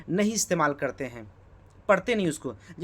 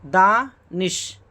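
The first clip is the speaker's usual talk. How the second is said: Danish drawn out very slowly and a bit louder; slow spelled-out word; 6 dB louder, much slower than usual. Shown as a demonstration. Teacher to students, Danish pulled out slowly to show its sounds. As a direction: stretch out Danish so students hear each sound. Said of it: "दानिशवरी लिखिए".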